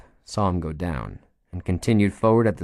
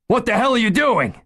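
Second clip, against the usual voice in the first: higher, louder, frantic yell; frantic shout; 5 dB louder than usual. Acted. Gunman losing control as the chief advances, frantic yell. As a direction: scream it as panic takes over.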